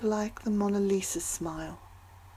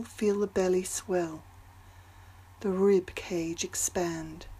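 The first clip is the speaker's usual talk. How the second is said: guided meditation. Acted. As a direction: ease them into calm with long pauses. Leads a breathing meditation.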